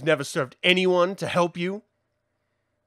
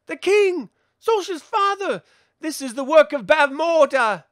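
shocked discovery; 7 semitones higher, 4 dB louder than usual. Acted. Discovering the cursed king.

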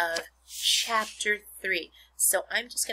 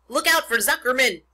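loud, raised warning yell; 6 semitones higher, 11 dB louder than usual. A narrator's reading. Gander warns the pig about Zuckerman, yelling. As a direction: bellow an urgent warning. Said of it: "yelled the gander".

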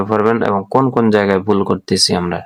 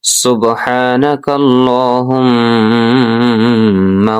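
slow recitation; much slower than usual. Shown as a demonstration. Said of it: "full recitation".

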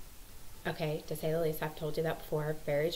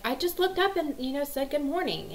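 put-on much higher, protesting kid voice; imitating innocent son; 8 semitones higher than usual. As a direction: play the son insisting he did nothing, pitched up. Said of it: "And he's like".

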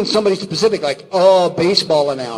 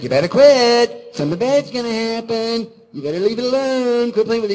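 cartoon warning; 6 semitones higher than usual. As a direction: stretch the warning out up high. Cartoon character warns Stimpy in retelling.

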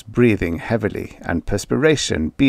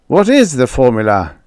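demanding; 4 semitones higher, 6 dB louder than usual. Acted. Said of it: "Joey demanded".

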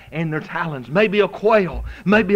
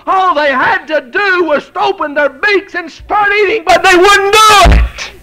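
rising, louder preaching; building sermon; 10 semitones higher, 8 dB louder than usual. Acted. Preacher pressing the congregation, building to a loud peak.

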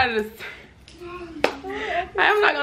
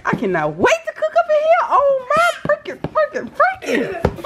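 gushing excitement. Real, not acted. Gushing over gift pot set.

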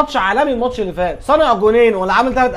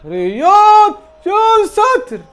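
excited shout; 9 semitones higher, 5 dB louder, much slower than usual. Real, not acted. Watching a dangerous attack.

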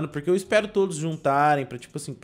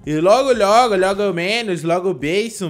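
loud, higher burst of playful exclamation; exclamação exaltada; 3 semitones higher, 8 dB louder than usual. Real, not acted. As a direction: belt it out, riffing on the words. Exclaiming wildly over the game. (exclamação bem alta e empolgada)

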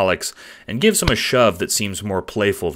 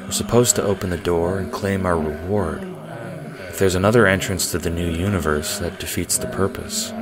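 Aradia monotone. Acted. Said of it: "simple monotone voice".

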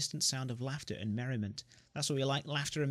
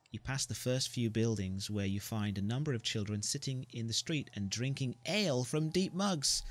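normal talking voice

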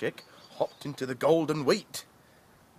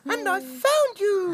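chick voice; 6 dB louder than usual. Acted. Chick voice continues.

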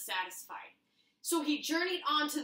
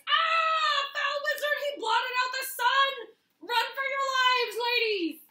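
much higher, louder mock panic; panicked cartoon screaming; 13 semitones higher, 10 dB louder than usual. Trail mix creatures fleeing the wizard in panic, shrieking. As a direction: shriek and flee in silly terror.

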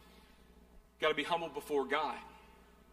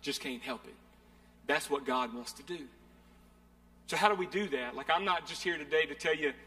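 ordinary talk

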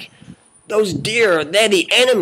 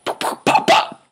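gun pops. Pretend. Gunshot imitation repeated.

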